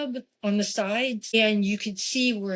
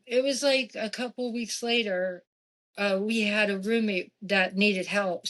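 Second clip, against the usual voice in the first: normal talking voice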